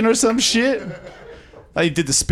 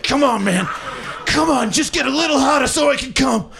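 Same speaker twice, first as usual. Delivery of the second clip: high strained shout, pleading with his own body; straining plea; 6 semitones higher than usual. Retold or imitated. Man pleading with his limp dick, high strained shout. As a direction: beg it to get harder, pitched up and yelling. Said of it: "I'm just like".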